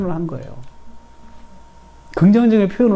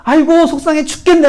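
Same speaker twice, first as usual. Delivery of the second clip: loud, high mock wail; exasperated parent's lament, pitched way up; 한탄하는 시늉; 12 semitones higher, 6 dB louder than usual. Shown as a demonstration. 부모의 한탄을 시늉함. (크고 높은 소리로 속상한 듯)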